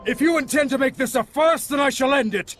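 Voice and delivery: high, intense challenge building to a shouted attack; battle challenge. Acted. Warrior challenging Sorey to show belief, battle challenge.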